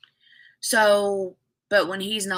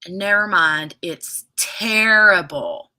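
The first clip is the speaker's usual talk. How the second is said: normal talking voice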